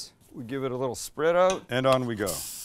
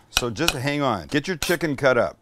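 normal talking voice

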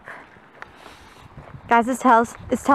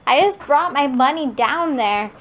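usual speaking voice

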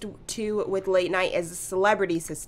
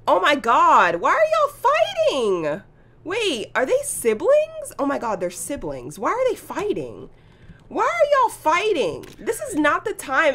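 high, loud gasping disbelief; shocked excitement; 7 semitones higher, 5 dB louder than usual. Real, not acted. Vlogger gasping as two Sims brawl in the park, shocked. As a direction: squeal at the brawl like you can't believe it.

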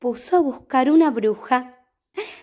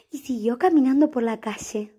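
plain reading voice

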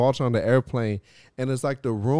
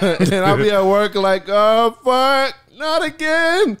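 laughing; 10 semitones higher, 8 dB louder, slower than usual. Retold or imitated. Retelling seeing fractals at work.

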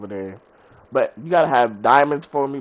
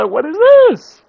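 slow, high, loud what-is-this; incredulous; 10 dB louder, slower than usual. Retold or imitated. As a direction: drag out the disbelief, much higher. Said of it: "I was like".

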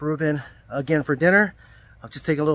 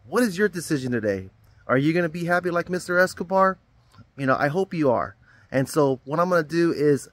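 ordinary talk, nothing marked

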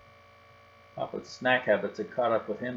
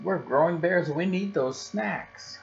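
higher, slow pleading cub voice; protesting cub; 7 semitones higher, slower than usual. Acted. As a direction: drag it out like a cub begging for snacks. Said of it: "they protested".

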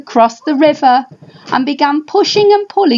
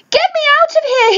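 high, fast cry to get out; yelled; faster than usual. Acted. Father dinosaur stuck in mud, yelling high and fast. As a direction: yell it quick and pitched up, panicking. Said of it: "he yelled".

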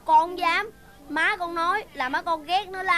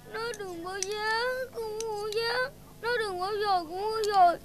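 put-on high, tearful child's whine; child sulking at grandpa over porridge; tearful whining; 4 semitones higher, 6 dB quieter than usual. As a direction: whine each word through sobs. Crying child refuses to eat.

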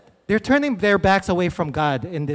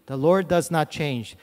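ordinary talk, nothing marked

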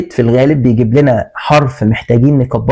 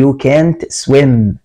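slow read-aloud; much slower than usual. Shown as a demonstration. Teacher reads ordered sentence.

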